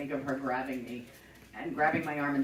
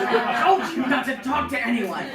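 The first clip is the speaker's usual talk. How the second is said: louder, higher, laughing while imitating a scolding mom; half-mock telling-off, louder and higher; scolding mother; 8 semitones higher, 10 dB louder than usual. Mother catches her, argument.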